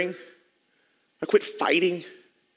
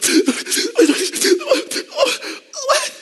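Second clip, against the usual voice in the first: choked cry-laugh. Real, not acted. Frozen, breath gone, breaks.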